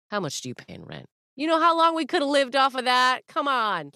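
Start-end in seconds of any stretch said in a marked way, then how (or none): 1.4-3.9 high, loud exasperation; indignant. Real, not acted. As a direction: protest the waste, voice pitched up. Indignant about wasted money.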